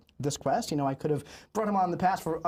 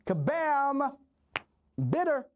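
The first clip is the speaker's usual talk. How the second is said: announcer introduction; 10 semitones higher, slower than usual. Acted. Host introduces guest, announcer style.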